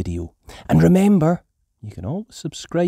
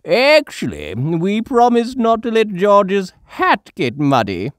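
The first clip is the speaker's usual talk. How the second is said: high, louder smug correction; Grandpa Pig voice; 11 semitones higher, 6 dB louder than usual. A narrator's reading. Grandpa Pig correcting Mummy about the promise. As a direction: correct her cheekily. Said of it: "says Grandpa Pig".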